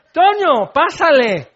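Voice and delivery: high, loud, sing-out invitation; hammed-up cordial call; hearty welcome. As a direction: welcome a neighbour in as if nothing happened. Welcoming the neighbour mid-quarrel.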